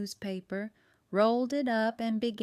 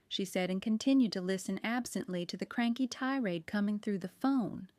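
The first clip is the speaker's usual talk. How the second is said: usual speaking voice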